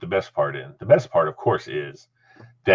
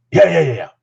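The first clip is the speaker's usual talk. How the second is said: impatient brush-off, raised pitch; grumpy; 8 semitones higher, 5 dB louder than usual. Retold or imitated. Head coach brushing off a hesitant assistant, grumpy. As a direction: wave him away with irritated repeats. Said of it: "He's all grumpy".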